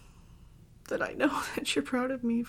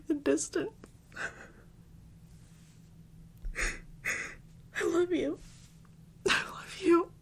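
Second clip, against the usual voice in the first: high, sobbing declarations of love; weeping and pleading between shaky breaths; tearful, composure lost; 5 semitones higher than usual. Acted. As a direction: cry it out, voice pitched up. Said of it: "guess I lost my composure".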